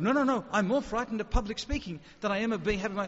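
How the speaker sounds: hammed-up panicked no no no; mock panic. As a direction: beg the gunman in mock terror. Pleading at gunpoint, mock panic.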